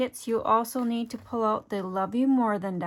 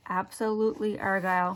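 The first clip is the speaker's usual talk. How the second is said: Absolutely Argyle said more slowly, each syllable distinct; slower, careful saying; faster than usual. Real, not acted. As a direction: take care over every syllable of "Absolutely Argyle". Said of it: "Say it a little slower".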